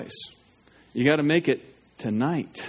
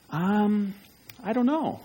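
higher, unsure mumble; hesitant convert; 4 semitones higher, 3 dB quieter, slower than usual. Retold or imitated. Pastor retelling a convert's unsure reply, higher and hesitant. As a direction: imitate someone caught without an answer. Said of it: "they said".

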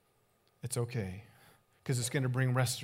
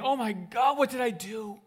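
alarmed apology; 7 semitones higher, slower than usual. Retold or imitated. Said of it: "you think".

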